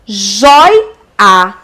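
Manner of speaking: "Joia" split as joi... a; "Joia" in two emphatic beats; syllable by syllable. Shown as a demonstration. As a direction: draw out joi in "Joia" before the final a. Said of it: "Veja que o oi".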